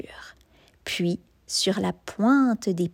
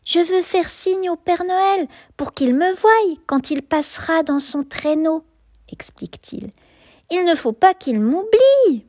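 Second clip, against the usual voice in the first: higher, eager cub voice; earnest little cub; little bear voice; 7 semitones higher, 4 dB louder than usual. A narrator's reading. Cub explains to wolf.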